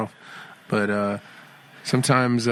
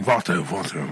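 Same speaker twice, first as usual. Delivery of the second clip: playful Mexican accent, pitched down; 4 semitones lower than usual. Shown as a demonstration. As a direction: lower Mexican-accented "vato". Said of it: "I gotta do Mexican".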